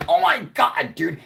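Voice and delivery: high-pitched, loud astonishment; amazed reaction. Retold or imitated. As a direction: relive being blown away. Retelling his own amazed reaction.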